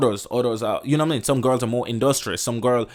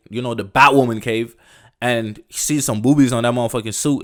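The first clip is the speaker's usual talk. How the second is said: ordinary talk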